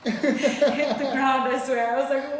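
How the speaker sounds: laughing loudly through the words; bursting out laughing mid-sentence. Real, not acted. Telling a friend about the floor, laughing loudly. Laughing while recalling regret.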